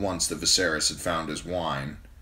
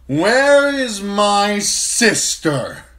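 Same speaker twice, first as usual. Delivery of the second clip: much higher, much louder, slow bellow; furious drunk prince shouting for his sister; drunken shout; 11 dB louder, much slower than usual. A narrator's reading. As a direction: roar it across the hall, drunk on wine. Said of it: "Viserys shouted".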